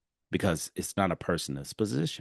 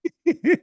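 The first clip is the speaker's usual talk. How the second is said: a quick, high burst of laughter; short laugh; 7 dB louder than usual. Real, not acted. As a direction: laugh at the punchline. Laughs at his own retort.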